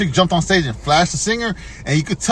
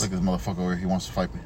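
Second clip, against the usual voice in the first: hushed aside; 9 semitones lower, 9 dB quieter, much faster than usual. Acted. Mock quiet aside about old man.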